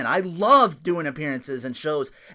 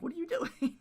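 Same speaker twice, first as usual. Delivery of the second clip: laughing disbelief; 13 semitones higher, 8 dB quieter than usual. Retold or imitated. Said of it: "I'm like".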